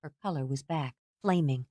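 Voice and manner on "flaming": usual speaking voice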